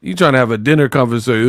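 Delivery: ordinary delivery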